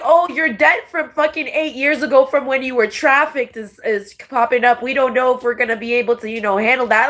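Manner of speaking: lightly voiced smug landlord; mock landlord. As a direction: mimic the landlord's brush-off. Mimicking landlord.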